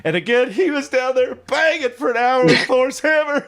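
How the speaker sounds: usual speaking voice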